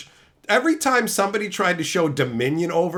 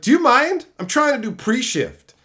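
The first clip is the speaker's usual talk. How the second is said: high, loud, snippy complaint; mock the annoyed manager; 7 semitones higher, 4 dB louder than usual. Manager scolding him in front of her team, snippy. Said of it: "It's like".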